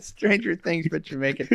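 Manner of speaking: playful mock Jamaican accent. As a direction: try it with a Jamaican lilt. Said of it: "say it in a Jamaican accent".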